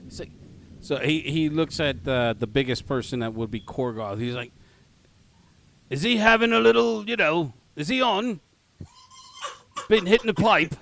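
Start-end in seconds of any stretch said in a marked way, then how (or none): 5.9-10.7 loud, high Cockney guard; comic Cockney insinuation; shitty Cockney accent. Acted. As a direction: ask suspiciously in bad Cockney. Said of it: "my shitty Cockney accent".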